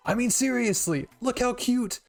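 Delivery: high, loud squeal of delight; squealing fangirl; cute gushing. Acted. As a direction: coo over how cute they are. Gushing over sponsor figures.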